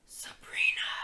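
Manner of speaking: eerie drawn whisper of a name; sinister whisper. Acted. Voice in the pit summons Sabrina, sinister whisper. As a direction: whisper it like something lurking in a pit. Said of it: "this sinister whisper just go".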